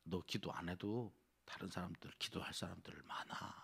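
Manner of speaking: low, hushed coaxing; sly tempter murmuring at the ear; tempting devil's voice. Acted. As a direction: softly talk them out of it, fading to near whisper. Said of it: "마귀가 끊임없이".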